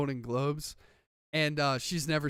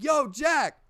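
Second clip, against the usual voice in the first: high retold hail; mock calling out; 9 semitones higher, 4 dB louder than usual. Retelling hailing an actor at the harbor, mock calling out. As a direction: lift it up like hailing someone passing. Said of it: "I was like".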